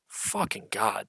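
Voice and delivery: usual speaking voice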